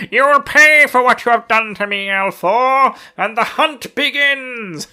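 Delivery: high, loud, laughing villain voice, slowing at the end; hammed-up cackling Fane threat; villain's cackling threat. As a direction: gloat through laughter like Fane. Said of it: "laughing there, cackling away".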